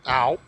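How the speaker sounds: sudden loud pained ow; loud yelp. Real, not acted. Player getting hit in the game, loud yelp.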